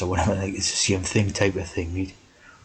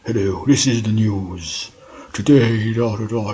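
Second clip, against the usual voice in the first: louder, plummy news announcer; posh newsreader voice; 3 semitones higher, 6 dB louder than usual. Retold or imitated. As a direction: put on a grand newsreader voice. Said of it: "speak with that posh voice".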